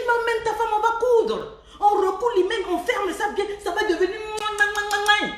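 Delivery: high, lively and teasing; playful high-pitched mockery; excited mocking retort. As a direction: tease him with a bright, high voice. Retelling her mocking reply.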